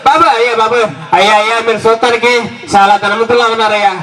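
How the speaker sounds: sing-song beggar's plea for alms; whining beggar. Acted. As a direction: wheedle like a street beggar working the crowd. Beggar act asking for alms.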